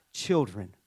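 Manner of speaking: tender call. Acted. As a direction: say Children tenderly, like calling your kids home. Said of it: "he cries out to them tenderly".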